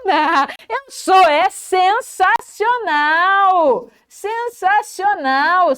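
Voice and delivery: forte, agudo, esticando "sensacional"; celebre-se sem modéstia, bem devagar; autoelogio efusivo. Shown as a demonstration. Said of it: "Se elogie também". (entusiasmo exagerado de quem se elogia)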